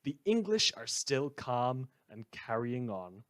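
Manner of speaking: normal talking voice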